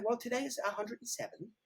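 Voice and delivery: English receptionist on the phone; English accent. Shown as a demonstration. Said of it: "I'm gonna just use the English accent".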